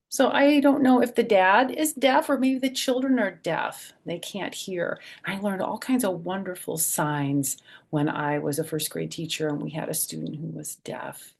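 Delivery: ordinary delivery, nothing marked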